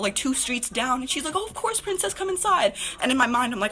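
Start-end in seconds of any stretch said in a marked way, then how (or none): 1.4-2.7 high-pitched sweet welcome; imitated angel coaxing someone in; angelic kind lady. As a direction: put on a high, sugary hostess voice. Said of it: "I finally find an angel".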